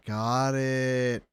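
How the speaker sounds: ordinary talk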